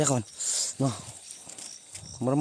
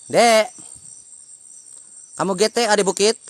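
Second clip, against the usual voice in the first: loud call up the hill, pitch raised; 6 semitones higher, 6 dB louder than usual. Real, not acted. Vlogger calling up the hill to unseen kids, loud call. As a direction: shout it out to someone far above.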